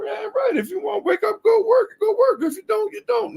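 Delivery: high-pitched mocking impression of the boss explaining right to work; mock southern boss. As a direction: squeal it like a smug boss. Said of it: "You know".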